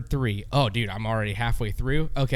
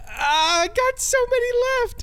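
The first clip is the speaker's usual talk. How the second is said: high frustrated whine. Real, not acted. Frustrated while blanking.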